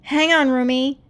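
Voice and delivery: calling out. Acted. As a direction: shout to reach her friend. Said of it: "Mira called".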